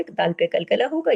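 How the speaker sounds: ordinary talk, nothing marked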